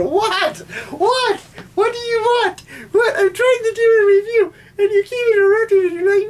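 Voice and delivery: high, exasperated crying whine; whiny at the cat. Real, not acted. Scolding the cat mid-review.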